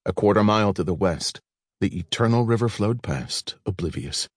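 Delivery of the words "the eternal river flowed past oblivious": usual speaking voice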